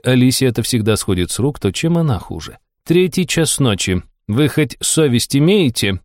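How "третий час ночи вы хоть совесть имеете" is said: slow, scolding tone; reproaching. Acted. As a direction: scold them like a warden. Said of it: "продолжала попрекать".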